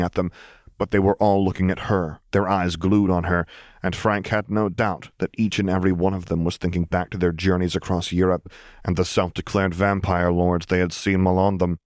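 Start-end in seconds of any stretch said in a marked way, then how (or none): none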